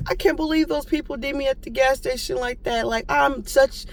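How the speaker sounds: an incredulous retold grumble; exasperated disbelief. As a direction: replay her own frustration in disbelief. Venting about the gas station.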